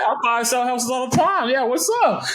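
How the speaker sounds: oversell it with a grin, mock-confident; overeager bluff. Retells overeager answer to seller.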